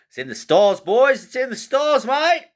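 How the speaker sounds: squealing high, loud Aussie cheer; mock Australian accent. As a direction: whoop it out in an exaggerated Australian accent. Hammed-up Aussie cheer for pick.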